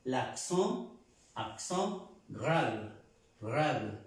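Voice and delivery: "accent grave" slowly, nasal O ending "accent"; teacher's slow French "accent grave"; French pronunciation. Shown as a demonstration. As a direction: model "grave" with its final E barely sounded. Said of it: "Y se pronuncia".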